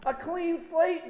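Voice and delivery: John shouting "A clean slate" at the crowd; shouted. Acted. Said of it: "he says".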